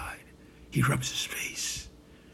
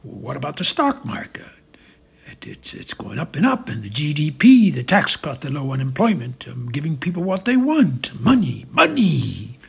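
building from usual voice to louder, insistent pitch; growing louder, eager self-justification; rousing himself; 8 dB louder than usual. Acted. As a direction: work yourself up as you list the wins. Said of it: "Trump rousing himself now".

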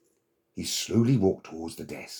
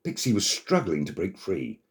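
ordinary delivery

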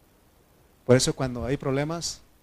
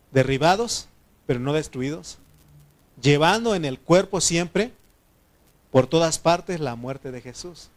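scripture declamation; 3 semitones higher, 7 dB louder than usual. Acted. Preacher reading Paul's words to the congregation, loud scripture declamation. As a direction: proclaim the verse, easing down at the end.

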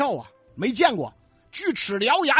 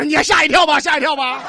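much louder and higher mock warning; teasing shout; 7 semitones higher, 12 dB louder than usual. The boy teases the merchant about his yaksha mother, loud and high. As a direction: tease him that he'll get a fright.

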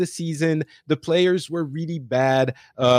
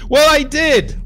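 excited; 13 semitones higher, 8 dB louder than usual. Real, not acted. Proud boast about reaching platinum.

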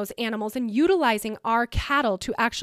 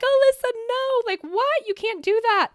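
much higher, mock-panicked parents' voice; imitating alarmed parents; 11 semitones higher than usual. Parents reacting to her moving to Mexico, mock alarm. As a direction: squeak it out like her horrified parents. They're like.

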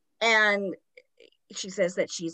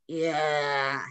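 imitating a raspy possessed voice, lower; raspy ghost voice; 4 semitones lower than usual. Retelling Janet's spirit voice answering Gross, raspy. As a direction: rasp out one eerie word. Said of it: "Janet's raspy voice goes".

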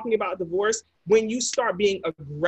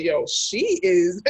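friends teasing; much slower than usual. Retold or imitated. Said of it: "everyone around them is like".

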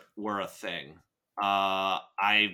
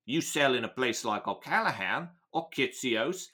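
put-on Cockney, lilting upward; amateur London gangster accent with rising okays; Michael Caine; 3 semitones higher than usual. Gang boss lecturing a dealer about territory, mock Cockney. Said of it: "lean a little bit toward Michael Caine".

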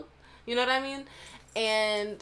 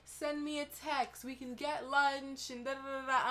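higher, softer chummy invite; friendly teacher voice; 6 semitones higher, 8 dB quieter than usual. Retold or imitated. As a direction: play him eager to catch up. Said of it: "He was like".

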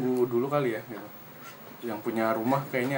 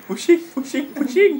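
high squeal; 6 dB louder than usual. Acted. Comic exclamation.

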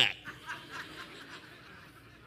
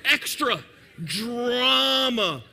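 mock dramatic drawl; 5 dB louder, much slower than usual. Mimicking daughter's theatrics.

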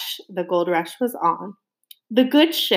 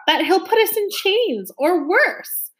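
frightened; 10 semitones higher than usual. A narrator's reading. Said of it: "very nearly caught in his throat".

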